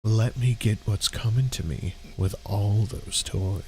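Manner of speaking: low, slow creepy purr; creepy sexy voice. Acted. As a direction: read it creepy and sultry. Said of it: "Stop reading in that voice".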